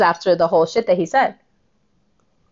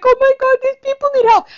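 much higher, syrupy mock pity; mocking gushing sympathizer; 3 dB louder, faster than usual. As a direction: parody the naive do-gooder.

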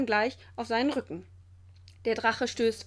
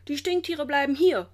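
loud, higher-pitched command; calling out; 5 semitones higher, 6 dB louder than usual. A narrator's reading. Director and keepers stop the skunk theft, calling out. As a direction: call out a firm prohibition. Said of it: "ruft der Direktor und die Tierwärter".

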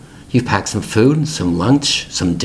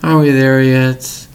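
slow, louder whine in a put-on child voice; whiny kid; 3 semitones higher, 4 dB louder, slower than usual. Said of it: "those expressions from our kids".

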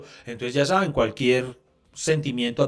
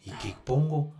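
softer, subdued question to oneself; stumped; 3 semitones lower, 8 dB quieter than usual. Acted. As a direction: voice drops as if at a loss. Acts out not knowing what to post.

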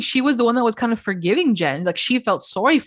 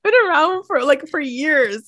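high, laughing disbelief, the voice pitched way up; incredulous laughing question; 10 semitones higher, 3 dB louder than usual. Retold or imitated. Recapping the show to her co-host, high incredulous laughter. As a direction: laugh through it, baffled and amused.

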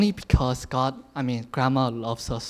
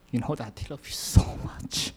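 holding back tears. Real, not acted. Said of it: "I'm not gonna cry".

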